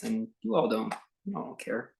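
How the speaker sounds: ordinary talk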